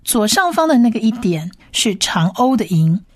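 ordinary talk, nothing marked

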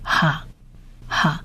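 "哈" as a soft, isolated ha; ha syllable demonstrated. Shown as a demonstration. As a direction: repeat "哈" softly, pausing between. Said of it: "这个字念哈". (轻声分开示范“哈”的读音)

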